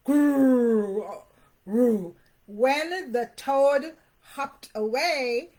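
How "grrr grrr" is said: louder playful growl; puppy growl. A narrator's reading. Puppy growling at the toad, playful growl. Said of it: "he growled".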